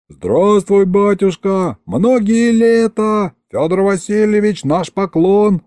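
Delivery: high, stretched-out cheering; crowd calling back. A narrator's reading. Townsfolk answer the governor's greeting, calling out in acclaim. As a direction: hail the ruler like a whole crowd. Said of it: "отозвалась толпа".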